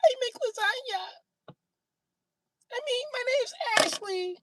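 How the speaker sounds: hammed-up falsetto flirt. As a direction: coo it out to win him over. Woman flirting with Brit.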